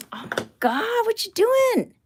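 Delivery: high alarmed "oh my God"; shocked outcry. Real, not acted. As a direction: yelp it in disbelief. Catches her pet chewing its bed.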